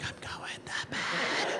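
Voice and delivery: slow, barely audible whisper; hushed, voiceless mock whisper; imitated whisper. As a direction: whisper it as if hoping not to wake anyone. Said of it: "whisper when she walks by".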